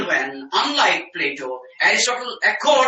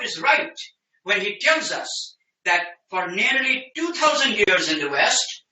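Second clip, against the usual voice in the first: ordinary talk, nothing marked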